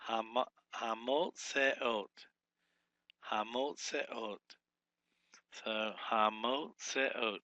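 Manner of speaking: Hamot Seot spoken slowly, syllables pulled apart, falling; syllable-by-syllable demo. Shown as a demonstration. Teacher sounding out a word for students, slow. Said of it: "Be four syllables".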